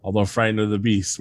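normal talking voice

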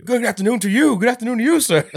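high, loud exaggerated old-timey voice; mock posh greeting. Scrooge insulting a passerby, high hammed-up posh voice. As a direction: sneer it with fake politeness.

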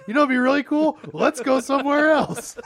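high, loud suggestion to friends; mock-casual urgency. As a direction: pitch it up and push it out brightly. Acted nervous suggestion to leave.

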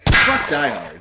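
loud, playful outburst; loud joking dismissal. Real, not acted. Host jokingly ditching Die Hard, loud playful exclamation. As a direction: blurt it out for the laugh.

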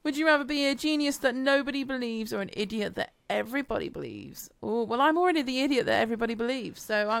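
normal talking voice

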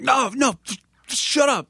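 high, squeaky mock exasperation at the kid; exasperated shutdown. To the zine interviewer, a high exasperated mock brush-off.

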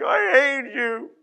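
put-on high, drawn-out sulky kid voice; teasing imitation of a sulky kid; child's whiny retort. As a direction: mimic a pouting child. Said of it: "and they're like".

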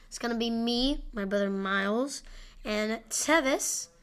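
ordinary talk

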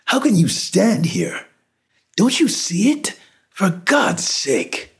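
high-pitched, panicked disbelief; frantic pleading. Acted. Horrified man to bystanders who ignore a body, frantic.

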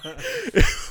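high-pitched laugh; real laugh; high burst of laughter. Real, not acted.

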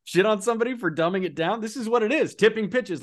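fired up and exasperated; exasperated high-pitched protest; indignant rant. Real, not acted. Indignant rant about dumbing down.

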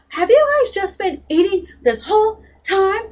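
high, loud, whiny indignant yell; a teen throwing a hissy fit; shrill hissy-fit rant at the guys; indignant outburst. Retold or imitated. Meg's hissy fit at breakfast.

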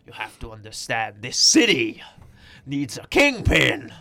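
slow, loud, deep-mouthed Kingpin; Kingpin impression. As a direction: overenunciate like a calm menacing crime boss. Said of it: "when you do a kingpin impression".

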